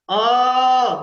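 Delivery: long loud chanted अ; drawn-out vowel a. Shown as a demonstration. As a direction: stretch अ into a long ringing sound. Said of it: "सबको एक साथ बोलना". (लंबा खींचा हुआ अ)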